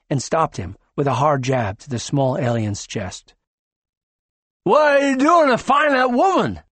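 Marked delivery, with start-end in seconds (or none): 4.6-6.6 much higher, louder confrontation; angry demand. Acted. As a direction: demand an answer, jabbing. Joker jabs and demands.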